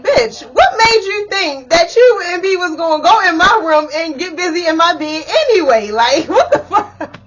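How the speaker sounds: loud, high, laughing mock outrage; retold laughing outburst. Telling her sister off over the bed, laughing and loud. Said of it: "I'm laughing, and I'm like".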